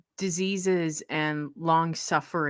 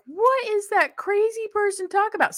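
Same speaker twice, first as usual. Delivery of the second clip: put-on kid's bemused question; 13 semitones higher than usual. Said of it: "almost be like".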